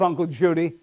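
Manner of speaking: ordinary talk, nothing marked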